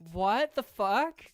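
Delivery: reacting aghast to a creepy punchline; real shocked outburst. Real, not acted. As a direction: stretch it out in loud amazement. Shocked by the AI's creepy joke.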